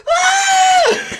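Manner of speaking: extremely high, loud shriek; panicked scream. Retold or imitated. Traumatized cop at a surprise party, terrified shriek.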